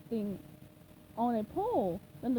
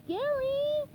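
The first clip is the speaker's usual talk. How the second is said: much higher call across the house; 13 semitones higher, 3 dB louder than usual. Acted. SpongeBob calling for his missing snail, high call. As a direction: call the pet's name loudly. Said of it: "he called again".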